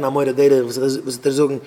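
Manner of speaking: ordinary talk, nothing marked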